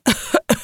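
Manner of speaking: short, dry staged coughing fit; put-on asthmatic cough; acted cough. A narrator's reading. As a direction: cough like a complaining granny. Old woman's acted asthma cough.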